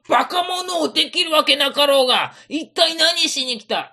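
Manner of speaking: high, loud angry retort; angry scolding. Acted. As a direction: scold them sharply. Samurai scolds visitors.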